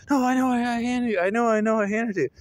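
retold frantic protest; mock freaking out. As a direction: imitate a panicking mom insisting, words tumbling out. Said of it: "And she's freaking out. She's freaking out".

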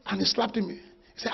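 ordinary talk

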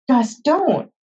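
high, urgent cry; alarmed pleading. Acted. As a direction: call out to hold him back. Frightened boy pleading with brother.

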